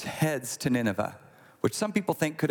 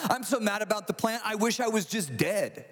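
higher-pitched mock exasperation; hammed-up anger; 6 semitones higher than usual. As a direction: escalate into an over-the-top acted huff. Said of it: "He's not just angry".

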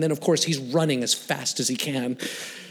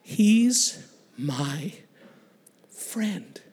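solemn declaration; much slower than usual. Retold or imitated. Churchgoer claims Wayne before the gang, slow hushed declaration. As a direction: set each word apart, ending hushed.

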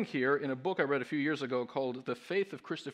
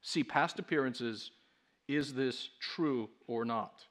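normal talking voice

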